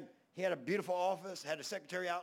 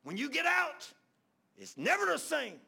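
preaching emphasis; 9 semitones higher, 6 dB louder than usual. Real, not acted. Sermon peak to congregation.

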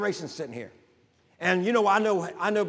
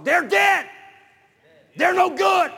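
high, loud pulpit shout; emphatic preaching shout; 11 semitones higher, 9 dB louder, slower than usual. Real, not acted. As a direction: shout it out to drive the point home.

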